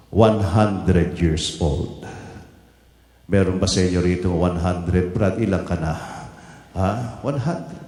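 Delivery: ordinary talk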